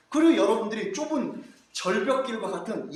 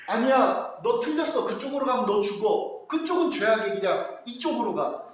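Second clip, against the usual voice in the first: firm scolding God; 3 semitones higher, 4 dB louder than usual. Acted.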